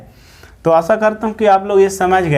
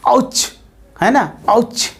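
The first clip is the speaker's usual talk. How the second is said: a sharp, higher "आउच" as if pricked by a pin; mock ouch; 5 semitones higher, slower than usual. Shown as a demonstration. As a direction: yelp "आउच" as if a pin just stuck you. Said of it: "pin चुभा दिया तो क्या बोलेंगे". (पिन चुभने पर हल्की "आउच")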